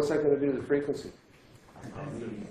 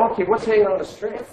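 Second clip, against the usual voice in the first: fast, bright re-ask with a higher lift on hanging; quick eager question; 7 semitones higher, 5 dB louder, faster than usual. Shown as a demonstration. Teacher re-asking the question quickly once the class gets it, fast and eager. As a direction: rattle off "what's hanging on the string" eagerly. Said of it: "It's, it's th-, there".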